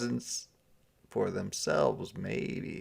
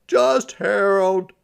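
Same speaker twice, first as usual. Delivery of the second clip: high, loud put-on Santa voice; a sighing, higher Santa; 10 semitones higher, 9 dB louder, slower than usual. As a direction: play him sulky and fed up.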